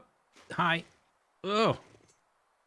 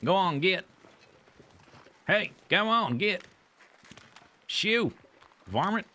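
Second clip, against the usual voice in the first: higher, louder hammed-up southern holler; southern accent; 10 semitones higher, 4 dB louder than usual. Gamer shooing a deer away, mock southern accent. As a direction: chase the varmint off in a southern accent. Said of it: "You gotta say it in a southern accent".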